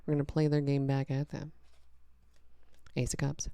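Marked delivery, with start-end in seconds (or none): none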